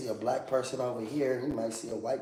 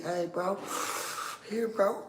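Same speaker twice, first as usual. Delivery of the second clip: calling out; 9 semitones higher than usual. Retold or imitated. Acting out calling a friend.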